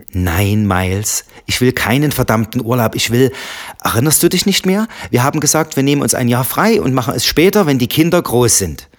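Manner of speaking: loud and high, frustrated; angry. Acted. Wife angrily rejecting husband's holiday idea, exasperated. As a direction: snap back in exasperation.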